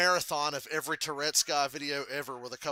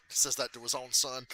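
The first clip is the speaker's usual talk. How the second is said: ordinary delivery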